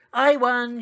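loud, high victory cry; triumphant shout. A narrator's reading. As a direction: shout it out like you just won the race. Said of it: "she shouted".

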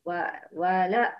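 normal talking voice

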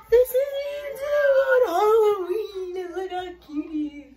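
very high, tearful squeal of adoration; gushing squeal. Real, not acted. Showing viewers Simba on Halloween, overwhelmed high squeal.

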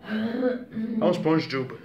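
a slow, high put-on cartoon voice, lightly done; SpongeDub voice. Kid playing SpongeDub, slow high cartoon voice. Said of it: "I'll be SpongeDub".

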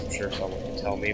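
ordinary delivery

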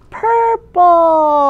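usual speaking voice

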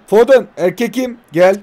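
normal talking voice

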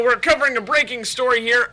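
ordinary talk

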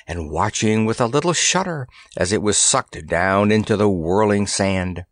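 usual speaking voice

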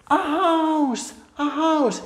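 very high, stretched storyteller awe; hammed-up amazement for toddlers; amazed wonder. Shown as a demonstration. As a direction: marvel as if it's the world's most spectacular thing. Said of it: "Y dices".

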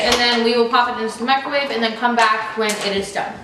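ordinary talk, nothing marked

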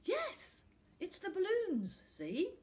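put-on high, eager child voice; a young boy pleading his case. A narrator's reading.

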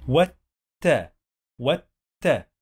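والت split as "wat", pause, "ta"; doubled ت. Shown as a demonstration. Teacher showing تاء two times, doubled ت in والت. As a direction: hold the t closed, then release "ta" in والت. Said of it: "We pronounce تاء two times".

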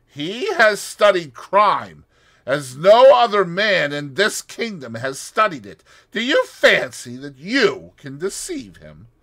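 loud, high doubting challenge; Russian doubting her plan; doubtful. A narrator's reading. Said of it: "shook his head doubtfully".

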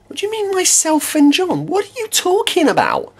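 a hint of exasperated disbelief; incredulous outburst. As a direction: squeak up in disbelief. Incredulous at "myself and John.

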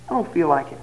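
low, soft sulky mumble; husband sulking back at wife, low and soft; whiny refusal. Retold or imitated. Husband refusing again, weaker.

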